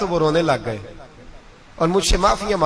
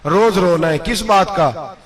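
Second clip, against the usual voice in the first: rising rhetorical build; 4 semitones higher than usual. Acted. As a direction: build the list up to a preaching climax. Preacher mocking daily complaints.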